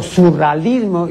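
"surrealismo" said without its e, as "surralismo"; misprinted word. Shown as a demonstration. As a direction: swallow the e in "surrealismo": "su-rra-LIS-mo". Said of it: "ya no es un error, sino una barbaridad". ("surrealismo" mal dicho, sin la e: "surralismo")

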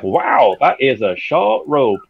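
slow, drawn-out mock wow; amazed. Tiny character seeing the captain's short robe, slow acted amazement. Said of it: "And she's like".

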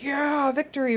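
imagined victory shout; triumphant cheer. Retold or imitated. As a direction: cheer it like grabbing the torch. Imagined triumph at peak of game.

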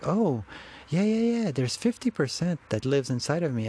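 an eager oh yeah that jumps way up, then settles; sudden realization. Retold or imitated. Suddenly realizing what the inner gorilla wants, excited.

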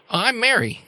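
put-on a girl's matter-of-fact self-introduction to a strange spirit; little girl Mary. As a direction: introduce yourself like a small, unafraid child. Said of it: "And then she says".

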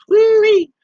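high, loud woo-wee holler; whoop. Real, not acted. Bear whoops about tasty humans, loud high holler. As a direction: whoop with delight.

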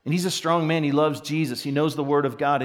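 normal talking voice

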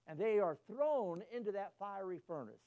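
normal talking voice

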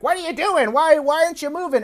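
high, exasperated yelling at the reindeer; frustrated Grinch. Retold or imitated. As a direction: squeal it out, annoyed and impatient. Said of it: "Grinch is like".

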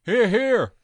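lone cheer. Acted.

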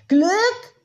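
loud, high-pitched outcry; crying out. A narrator's reading. Tiziana reacts to the woman's talk of luck, crying out. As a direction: yell it out in disbelief. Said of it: "schrie Tiziana auf".

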